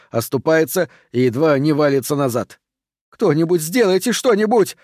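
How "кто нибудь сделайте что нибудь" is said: high, urgent plea for help; crying out. A narrator's reading. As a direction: plead in alarm, pitched high. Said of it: "кричит Меган". (встревоженный высокий крик)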